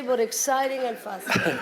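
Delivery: usual speaking voice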